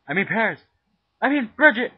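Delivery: high, loud self-correcting shout; loud correction. Real, not acted. Cook corrects herself, shouting. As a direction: yell the correction.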